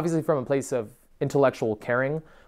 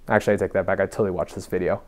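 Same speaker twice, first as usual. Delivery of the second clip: low monotone drone, for demonstration; 5 semitones lower than usual. Shown as a demonstration. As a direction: keep droning in a low, level voice. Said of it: "in a fucking monotone voice".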